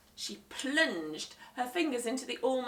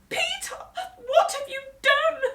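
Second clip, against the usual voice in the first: much higher, louder wailing scold; shouted dismay; 10 dB louder than usual. Acted. Mum scolding Peter after the crash, shouted dismay. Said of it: "shouted Mum".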